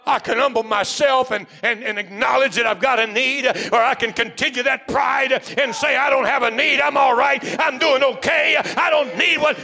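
full-force preacher; fiery preaching. Acted. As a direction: drive it home to the congregation.

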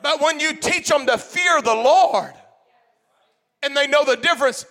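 loud, high preacher's shout; full-force preaching. Acted. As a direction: preach it out to the whole congregation.

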